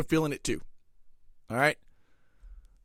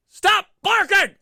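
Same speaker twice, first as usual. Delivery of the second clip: loud high yell at the chihuahuas; yelling at dogs; 10 semitones higher, 6 dB louder, slower than usual. Real, not acted. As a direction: shout them down to stop barking.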